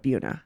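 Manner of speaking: Buna said BYOO-nuh; local pronunciation. Shown as a demonstration. Host reporting what locals call it, Buna as BYOO-nuh. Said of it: "many people over the years call it".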